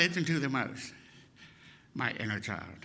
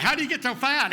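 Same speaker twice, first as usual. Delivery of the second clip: put-on higher, louder taunting kid; mock taunt; 9 semitones higher, 4 dB louder than usual. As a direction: sneer it like a rude schoolkid. Kids mocking his weight.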